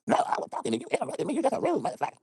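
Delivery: high mocking woman voice, rising; the woman snapping back at him in a high mock voice. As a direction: put on the woman's whiny protest. Quarrel, voicing the woman.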